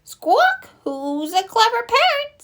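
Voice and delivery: very high parrot mimicry; high-pitched bird voice, parroting the words; squawking parrot. A narrator's reading. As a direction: squawk it back like a pet parrot. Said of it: "Polly is very good at repeating".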